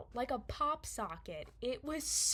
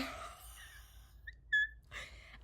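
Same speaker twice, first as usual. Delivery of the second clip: very high, thin mock squeal; imitated horrified noise. Storyteller imitating seeing the bone sticking out, high mock squeal. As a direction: let out a high wheezing squeak. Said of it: "I looked down, and I was".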